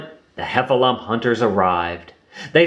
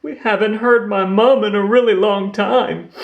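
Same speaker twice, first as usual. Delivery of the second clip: sniffly; 11 semitones higher, 3 dB louder than usual. Acted. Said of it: "Lumpy sniffed".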